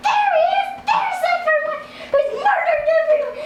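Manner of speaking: high, hurried crowd outcry; comic high-pitched alarm; mock accusing cry. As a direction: cry out like outraged wedding guests. Wedding guests accuse the killer.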